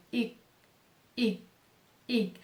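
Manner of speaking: I said lightly with the tongue briefly blocking air; a soft y sound on I. Shown as a demonstration. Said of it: "Levemente, ó". (I leve, com a língua interrompendo o ar)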